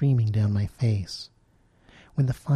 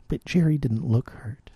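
ordinary delivery, nothing marked

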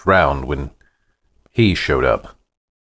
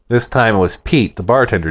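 ordinary delivery, nothing marked

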